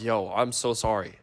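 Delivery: smooth it over with a quiet sorry; quiet embarrassed apology to the girls; apologetic mumble. Retold or imitated. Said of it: "I'm like".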